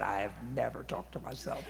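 soft, low mock-innocent denial. Speaker denying to coworkers, mock-innocent and soft. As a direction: murmur it like a fake innocent protest. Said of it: "I said".

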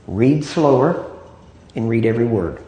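slow, spaced-out teaching delivery; slow, deliberate. Real, not acted. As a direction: draw out each word of the advice. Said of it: "I know I'm talking slow".

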